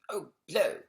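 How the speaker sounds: high loud fed-up cry; exasperated exclamation. Acted. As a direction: blurt it out, fed up.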